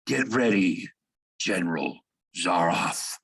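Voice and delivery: husky, menacing challenge to an enemy; low, hoarse voice. A narrator's reading. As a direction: rasp it out like a beast at bay. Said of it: "he says in a low, hoarse voice".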